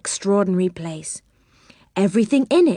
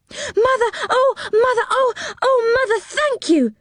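put-on much higher, overjoyed children crying out; excited cry. A narrator's reading. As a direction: burst out with delighted thanks. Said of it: "cried the children".

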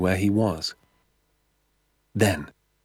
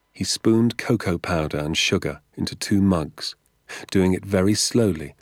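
ordinary delivery, nothing marked

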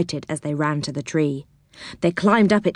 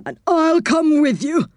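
put-on higher, eager old saucepan man; 8 semitones higher than usual. A narrator's reading. Saucepan offering to go with Moonface, high eager voice. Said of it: "said Saucepan".

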